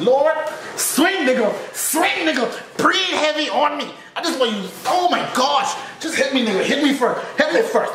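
begging him to swing, high and eager; laughing plea for the first hit; high excited pleading. Retold or imitated. Inner plea for a fight.

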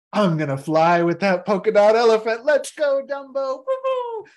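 high, loud, whooping excitement; gleeful flying. Acted. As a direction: whoop like a kid on a ride. Flying with dream elephant.

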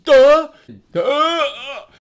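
loud, drawn-out duhs; big exaggerated mock-dumb exclamations. Shown as a demonstration. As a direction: start high and forceful, then drop to a groan. Answers absurd claim with duhs.

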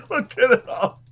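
high voice breaking with laughter; laughing. Real, not acted. Friend caught checking the word, laughing. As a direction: say it through a laugh. Laughing after being doubted.

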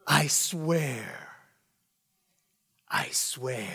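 hushed awed whisper. Acted. Passenger ending the movie quote, whispered. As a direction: breathe it out as a vow.

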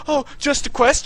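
high, loud sarcastic parroting; mocking echo. [name] parroting the gamer's excuse, loud and mocking.

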